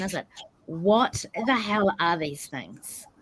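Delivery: ordinary talk, nothing marked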